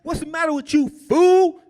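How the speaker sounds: scared man yelling fake tough-guy threats; tough talk. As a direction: fake bravado shouted at Rambo. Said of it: "I'm just talking all kind of junk".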